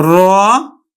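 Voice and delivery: রতন said clearly with middle অ as ও; teacher models রতন with an o sound; pronunciation demo. Shown as a demonstration. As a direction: say রতন as ro-ton, drawn out. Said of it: "এখানে উচ্চারণ হবে কিন্তু".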